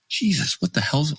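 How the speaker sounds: a sudden high yelp of surprise; mock-shocked golf buddy. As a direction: jump up high in disbelief. Buddy shocked by his slow walk.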